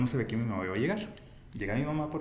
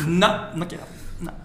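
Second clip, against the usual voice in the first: mock refusal, higher and louder; joking pleas not to wrap up; playful protest; 6 semitones higher, 4 dB louder than usual. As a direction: pretend you refuse to leave. Joking refusal to end chat.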